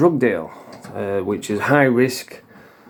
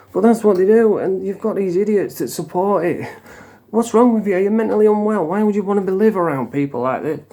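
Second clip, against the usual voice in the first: indignant rant; 7 semitones higher than usual. Real, not acted. Ranting at supporters of immigration.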